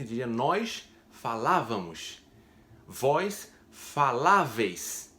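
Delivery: Vós faláveis drawn out slowly; slow careful conjugation. Shown as a demonstration. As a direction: stretch faláveis out syllable by syllable. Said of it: "quando a gente vai dizer". (pronúncia lenta de faláveis)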